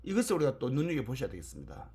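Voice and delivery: usual speaking voice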